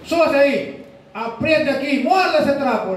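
high-pitched, emphatic commands; acted urgent orders; stern nurse orders. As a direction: play a nurse bossing a patient before surgery.

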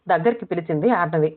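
ordinary delivery

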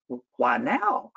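slow, puzzled North Carolina drawl; slow, innocent North Carolina question; North Carolina accent. Retold or imitated. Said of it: "she had this wonderful North Carolina accent".

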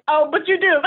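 high, giggling "oh, but you do"; laughing concession. Retold or imitated. Admitting the stranger was right, laughing. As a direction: give in with a laugh. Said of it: "but it was like".

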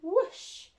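whoosh. A narrator's reading. Narrator voicing the broom's takeoff, rising whoosh. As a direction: sweep it up like takeoff.

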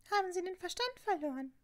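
high-pitched scolding matron voice; indignant Fat Lady. A narrator's reading. Portrait telling off two noisy students, high and indignant. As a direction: put on a high, affronted voice.

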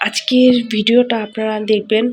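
short of breath, whispering. Real, not acted. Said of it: "আমি কথা বলতে পারছি না".

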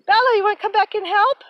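high, loud cheerful coaxing; high sing-song coaxing. Real, not acted. Coaxing granddaughter to garden.

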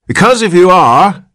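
put-on high, loud whiny old man; querulous old man. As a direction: complain like a cranky old man. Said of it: "went on the old man querulously".

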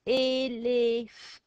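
Elef said loud, open E, no T; French sound-out. Shown as a demonstration. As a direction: sound out Elef loudly without the T. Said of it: "not gonna sound the T".

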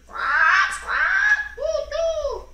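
very high loud squawks; panicked bird cries. Acted. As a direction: squawk like fleeing birds. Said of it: "They're going".

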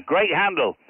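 ordinary talk

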